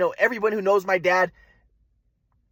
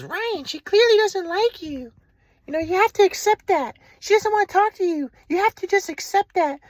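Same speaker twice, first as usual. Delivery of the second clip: mom's voice; 10 semitones higher than usual. Retold or imitated. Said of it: "my mom says".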